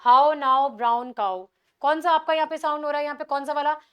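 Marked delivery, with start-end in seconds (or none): 0.1-1.5 how now brown cow, very slow and louder on each ow; stressing the ow sound. Shown as a demonstration. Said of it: "Which vowel sound is repeated".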